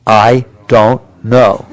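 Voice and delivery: slow call-and-response "I don't know"; slow, drawn-out I don't know. Shown as a demonstration. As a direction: stretch "I don't know" out slowly, word by word. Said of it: "Say it with me".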